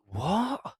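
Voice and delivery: high, startled "What?" in disbelief; shocked what. Retold or imitated. Retelling his reaction to the Iraq call-up letter, startled disbelief. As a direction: react like the letter makes no sense.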